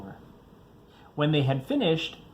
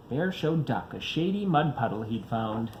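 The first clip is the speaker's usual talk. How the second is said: usual speaking voice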